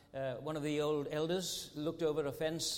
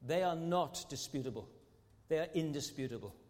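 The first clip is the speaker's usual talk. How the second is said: normal talking voice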